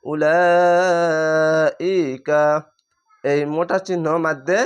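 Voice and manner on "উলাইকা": উলাইকা, very slow, one sustained note on "laa"; four-alif madd. Shown as a demonstration. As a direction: stretch the "laa" of উলাইকা very long before "ika". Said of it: "চার আলিফ টেনে পড়বেন".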